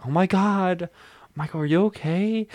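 a hint of mock worry; lightly voiced worried friend. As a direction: friend fusses over the fall. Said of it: "He's like".

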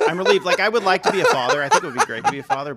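laughing through the words; giggling while trying to sound relieved. Real, not acted. Man laughing at news he's not a father, laughing.

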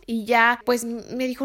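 usual speaking voice

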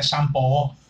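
shampoo con sh suave y o final; pronunciación de niño. Retold or imitated. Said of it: "Decía shampoo". ("shampoo" leído con o final)

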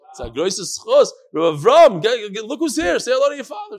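loud, high, bustling introduction; excited calling out. Real, not acted. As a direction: call everyone over to see the guest. Calls others over to the visitor.

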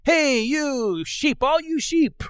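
high, drawn-out call to a flock; mock shepherd's holler. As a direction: hail them in a stretched, high-pitched voice.

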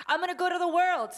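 high, loud, fast defiant threat; mock defiant girl declaring she will leave; defiant disciple. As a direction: fling it like a rebellious young woman. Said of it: "disciple one day that told me".

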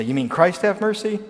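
high, pointed question; mock defiant congregation. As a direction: needle the emperor with it. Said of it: "the congregation say".

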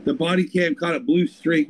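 ordinary delivery

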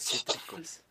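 whispered echo. Pretend. Joke echo of pun.

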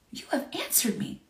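hushed, reverent whisper; awed whisper. Real, not acted. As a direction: breathe it out in a whisper. Repeats the verse to savour it.

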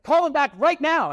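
high, loud insistent order; bossy demand. Retold or imitated. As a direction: bark the demand like an impatient boss. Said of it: "she's like".

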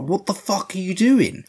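high-pitched incredulous question; mock disbelief. Cops come upon the sheriff's execution, mock disbelief.

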